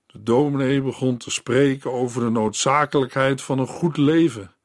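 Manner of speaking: ordinary delivery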